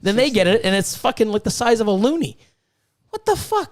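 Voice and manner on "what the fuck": high, squeaky imitation of a shocked buyer; imitating a new owner seeing a tiny snake; mock shock. A buyer opens the box and finds a tiny snake, high mock shock.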